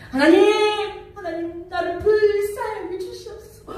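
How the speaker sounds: high, loud desperate plea; crying out to God. Retold or imitated. A fasting mother crying out to God, high desperate plea. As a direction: cry out to God for the lost son. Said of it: "날마다 하나님께 외칩니다".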